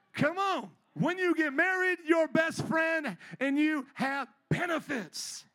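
loud, high shouted exhortation; preacher's shout. Real, not acted. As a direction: shout it out to the congregation, stretching the last words. Preacher shouts his punchline.

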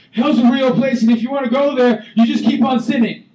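high, loud ranting threat; hammed-up mean preacher rant. Shown as a demonstration. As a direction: bark it like a crazy street preacher. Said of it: "not to be really super crazy and just mean".

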